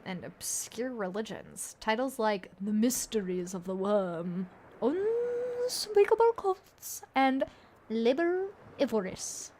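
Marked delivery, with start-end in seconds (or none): 2.6-9.5 ominous reading. A narrator's reading. GM reads ominous book titles.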